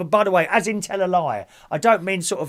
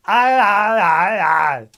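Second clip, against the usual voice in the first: loud, high aye aye chant; silly cheer; 4 semitones higher, 8 dB louder, much slower than usual. Acted. Noise Martin likes.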